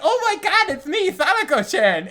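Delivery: put-on high, loud, giggly girl voice; squealing Sadako. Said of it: "Because Sadako is like".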